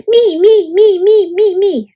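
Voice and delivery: high, loud me me me; high eager shout. A narrator's reading. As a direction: shout to be picked first. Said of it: "Froggy shouted".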